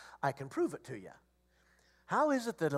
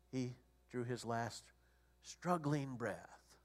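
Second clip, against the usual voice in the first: hushed grief; 7 semitones lower, 12 dB quieter, much slower than usual. Real, not acted. Telling of father-in-law's death.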